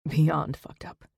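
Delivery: bitter whisper. Acted. Zack answering Saber in a bitter whisper. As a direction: breathe it out, no voice.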